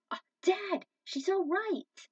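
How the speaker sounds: high, loud relieved call; joyful relief. A narrator's reading. Son tells father Cheetah is safe, relieved. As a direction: tell Dad the good news, thrilled.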